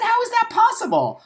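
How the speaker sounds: jumps way up high and loud in shock; outraged disbelief. Retold or imitated. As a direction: retell the call as if stunned all over again. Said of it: "I said".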